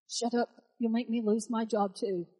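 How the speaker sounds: gruff lion hissing back; a hint of a grumpy lion; lightly voiced lion hissing shut up. Lion speaks from the pit.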